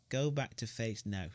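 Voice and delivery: usual speaking voice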